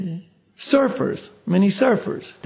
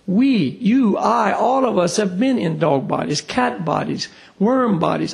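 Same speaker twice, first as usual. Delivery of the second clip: ordinary talk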